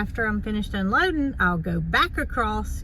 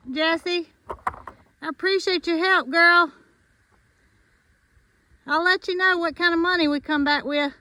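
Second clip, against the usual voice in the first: high sweet voice for an animal; pet voice; 6 semitones higher, 4 dB louder than usual. Real, not acted. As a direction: coo it to the animal. Thanking Jesse the animal.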